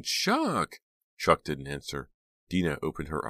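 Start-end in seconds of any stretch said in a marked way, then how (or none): 0.0-0.8 very high shriek, less loud. A narrator's reading. Jade shrieking at the fallen Chuck, high shriek. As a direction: shriek his name once more. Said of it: "Jade was shrieking".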